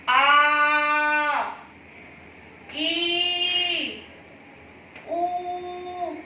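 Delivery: आ, ई, ऊ each held twice as long and loud; long vowels. Shown as a demonstration. Teacher drawing out long vowels for her pupils. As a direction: stretch आ, ई and ऊ out for a class. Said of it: "ह्रस्व स्वरों से दुगना समय".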